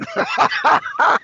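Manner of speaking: high, breathless cackle; wheezing laugh. Real, not acted. Streamer amused by his own Pops impression, wheezing laughter. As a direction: crack up in a long wheeze.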